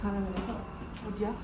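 muffled behind mask; speaking under mask cover; through a face mask. Real, not acted. Seen 0: Cloth face mask covers her mouth and nose while she speaks.

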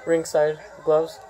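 usual speaking voice

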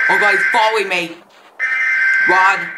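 fast, loud, terrified shouting; panicked yelling. Real, not acted. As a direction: flee in a frantic panic. Chased by monster, panicking.

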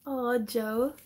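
ordinary talk, nothing marked

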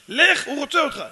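high, loud order to go; acted pressing urgency; urgent command. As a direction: send him off with a shout. Stranger urgently sends the son.

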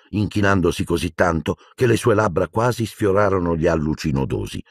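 ordinary delivery

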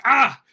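sharp high yelp of delight; excited yelp. Retold or imitated. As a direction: yelp like grabbing a power-up. Excited Mario power-up exclamation.

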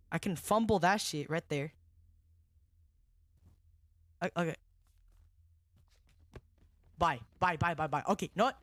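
usual speaking voice

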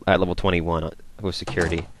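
usual speaking voice

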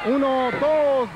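slow, loud referee count; counting the pin. Real, not acted. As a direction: call out each count slowly.

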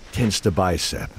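ordinary talk, nothing marked

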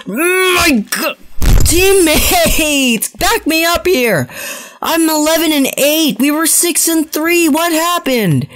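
high, pleading whine; exasperated whine. Real, not acted. Gamer begs teammates for backup, high exasperated whine. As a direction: beg teammates for help. Losing streak, begging teammates.

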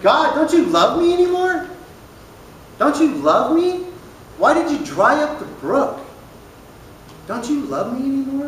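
high, loud pleading whine; a needy prophet's high wail; whiny Elijah. Acted. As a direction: beg God like a hurt kid. Elijah whining at God.

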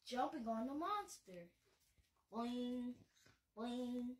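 put-on storybook voice for kids; bedtime-story narration; reading to children. A narrator's reading. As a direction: read it like a picture book to little ones. Reads picture book aloud.

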